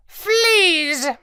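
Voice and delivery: high delighted squawk; gleeful parrot cry. Acted. Parrot gloats over his box of fleas. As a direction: relish the word.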